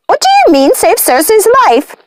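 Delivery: high, frantic witch voice; frenzied witch. A narrator's reading. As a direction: demand answers in a frenzy. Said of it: "who was in a frenzy".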